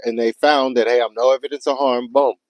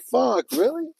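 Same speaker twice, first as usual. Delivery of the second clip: high, stunned "really?" of outrage; incredulous outburst; 12 semitones higher than usual. Retold or imitated. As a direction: pitch jumps much higher in disbelief. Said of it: "So I'm like".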